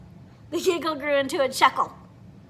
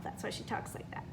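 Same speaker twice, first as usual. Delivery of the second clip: usual speaking voice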